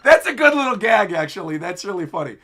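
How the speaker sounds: real giggles breaking up the words; laughing. Real, not acted. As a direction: crack up at a gag while talking. Cracks up at the gag.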